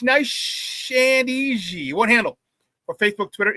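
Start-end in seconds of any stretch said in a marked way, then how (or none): 0.0-1.9 slow and easy. Shown as a demonstration. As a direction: stretch it out, nice and easy. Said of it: "nice and easy for you folks".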